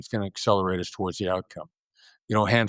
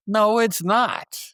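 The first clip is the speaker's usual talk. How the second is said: emphatic denial; 10 semitones higher, much slower than usual. Real, not acted. Flat rebuttal of the commandment.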